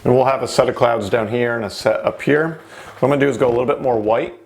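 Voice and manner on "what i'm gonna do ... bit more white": normal talking voice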